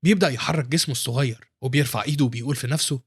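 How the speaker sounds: ordinary delivery, nothing marked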